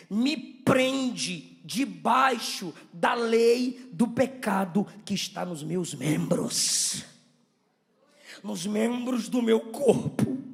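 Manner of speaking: loud, very slow declamation; emphatic preaching. Real, not acted. Preacher declaring the law of sin to the church, slow and emphatic. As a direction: hammer out each phrase of the verse.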